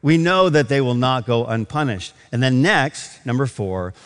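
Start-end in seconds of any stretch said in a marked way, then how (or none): 0.0-2.1 a preacher pressing the point from the pulpit, louder; fervent preaching. Acted. Preacher warning the church about lying, in a fervent raised voice. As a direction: build it like a sermon climax.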